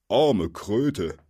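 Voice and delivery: hint of a gruff, pitying troll; lightly voiced pitying troll. A narrator's reading. Bill pitying the captive, lightly voiced troll.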